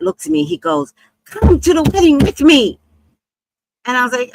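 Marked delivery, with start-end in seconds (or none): 1.2-2.8 loud, high, insistent invitation; hammed-up eager plea; Flint urging. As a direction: imitate an excitable friend pressing hard.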